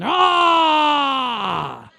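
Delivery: put-on loud, high battle cry of a toddler; Braveheart yell. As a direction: roar it like a tiny Braveheart. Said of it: "Like Braveheart".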